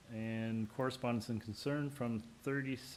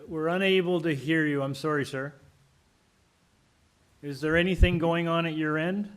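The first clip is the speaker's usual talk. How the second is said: louder; 4 semitones higher, 10 dB louder than usual. Real, not acted. Chair calling to unheard caller.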